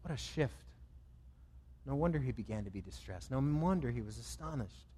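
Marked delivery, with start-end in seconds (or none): none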